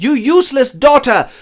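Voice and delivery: high, loud angry scolding; berating father. Acted. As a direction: shout down your child with hammed-up fury.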